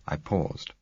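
usual speaking voice

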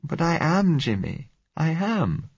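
put-on frightened girl pleading. A narrator's reading. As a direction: beg him not to leave, rising worry.

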